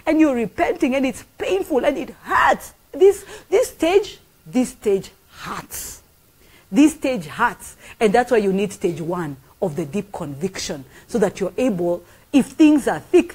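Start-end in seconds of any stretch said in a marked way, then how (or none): 2.9-7.8 dragged out slowly, each word weighted; slow emphatic. Real, not acted. Preacher slowly stressing to congregation how repentance hurts. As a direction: lean hard on the pain, slowly. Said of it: "preacher dwells on pain".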